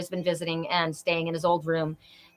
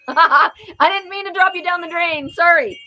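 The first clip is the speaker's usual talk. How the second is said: higher and louder, laughing through it; laughing sheepishly, much higher and louder; laughing apology; 10 semitones higher, 7 dB louder than usual. Real, not acted. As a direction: giggle out a sorry. Laughing apology after disconnecting someone.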